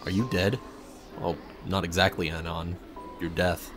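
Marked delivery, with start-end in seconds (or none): none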